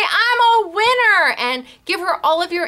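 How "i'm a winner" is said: cheery winner line. Pretend. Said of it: "you're gonna say".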